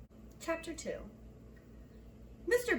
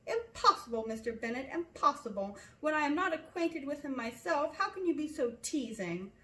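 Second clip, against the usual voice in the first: higher, louder protesting wife; exasperated wife; 5 semitones higher, 4 dB louder than usual. A narrator's reading. Wife refusing husband's suggestion, exasperated. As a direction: throw up your hands, exasperated.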